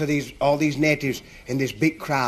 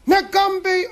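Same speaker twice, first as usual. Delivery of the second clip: high-pitched mock crowd cheer; frenzied crowd cry. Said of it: "they were going up the wall".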